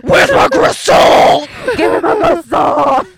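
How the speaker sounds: shout. Pretend. Blooper shouting food names.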